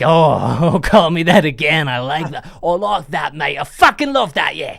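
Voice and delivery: put-on loud, laughing British accent, delighted; hammed-up British bloke loving the nickname. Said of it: "I always get a bit British with that".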